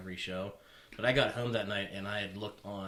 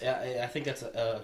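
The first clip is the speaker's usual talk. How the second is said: normal talking voice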